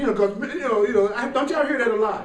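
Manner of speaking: ordinary delivery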